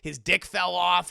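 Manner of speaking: ordinary talk, nothing marked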